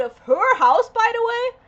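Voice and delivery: loud, high-pitched outraged aside; indignant. Real, not acted. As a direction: raise voice and pitch in disbelief. Indignant about friend thrown out.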